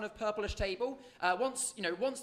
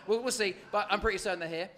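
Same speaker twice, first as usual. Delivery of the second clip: usual speaking voice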